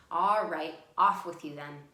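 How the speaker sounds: loud, gruff troll dismissing the goat. Acted. Troll waving the goat off the bridge, loud and gruff. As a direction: boom it out like a grumpy troll letting him go. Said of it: "So he says to the goat".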